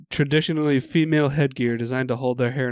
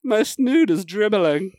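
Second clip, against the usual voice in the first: high, slow fussy whine; hammed-up period voice; mock aristocrat lament; 6 semitones higher, 4 dB louder, slower than usual. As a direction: mourn the snood theatrically. Period-drama parody continues.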